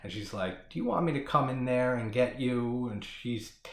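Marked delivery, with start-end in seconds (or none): 0.7-2.9 a hint of a creepy demonic child; lightly voiced menacing kid coaxing her out; mild sinister invitation. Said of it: "talking in the demonic voice".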